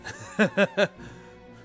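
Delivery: high, short burst of laughter; amused laugh. Real, not acted. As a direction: laugh it off after teasing. Streamer amused at own remark.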